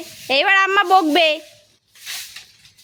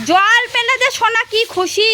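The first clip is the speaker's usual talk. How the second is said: usual speaking voice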